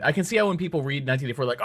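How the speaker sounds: ordinary delivery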